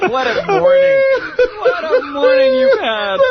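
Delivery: laughing. Real, not acted. Laughing at the prank story.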